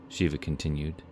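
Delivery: usual speaking voice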